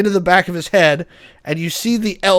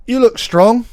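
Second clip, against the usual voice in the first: put-on British accent; 6 semitones higher than usual. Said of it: "The British guy goes".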